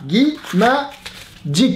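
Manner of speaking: "Guy Magic" drawn out very slowly; slow, exasperated pauses inside "Guy Magic"; syllable by syllable. Real, not acted. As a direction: break "Guy Magic" into three separate syllables. Spells out brand name, exasperated.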